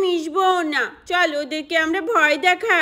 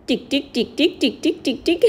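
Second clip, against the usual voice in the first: tiptoe ticking; 4 dB quieter than usual. Acted. Sneaking round the pond.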